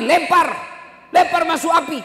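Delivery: high, loud order to throw him in; commanding shout. Retold or imitated. The Prophet ordering him thrown in, loud command. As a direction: cry out the command. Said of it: "Kata Nabi".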